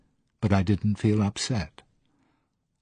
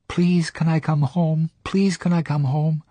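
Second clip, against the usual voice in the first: higher, louder pleading, a touch of tears; retold desperate pleading; 9 semitones higher, 4 dB louder than usual. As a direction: beg to come home like the frightened girl again. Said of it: "I was crying hysterically".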